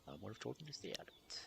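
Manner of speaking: hushed and fast, tossed off under the breath; rushed soft muttered afterthought; quick quiet aside. Acted.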